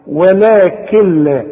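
very slow ولكن لا with the noon dropped into the lam, Walakel-la; merge noon into lam. Shown as a demonstration. Teacher demonstrating idgham for the student. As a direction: join the kaf to the lam and swallow the noon. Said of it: "حشبك الكاف زي ما قلت لك".

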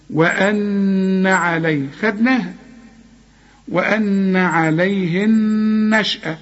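slow, hold the doubled noon in وأن with a nasal hum; nasal ghunna. Shown as a demonstration. Teacher shows student the ghunna, slow nasal recitation. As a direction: let the noon of وأن ring through the nose. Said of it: "شوف النون بتغني إزاي؟".

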